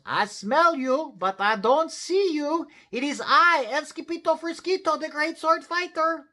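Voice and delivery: high, loud heroic announcement; calling out. A narrator's reading. Skipito announces himself to unseen amigos, calling out. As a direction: proclaim yourself in a high, ringing voice. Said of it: "called out Skippy John Jones".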